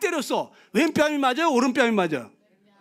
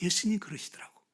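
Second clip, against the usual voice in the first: usual speaking voice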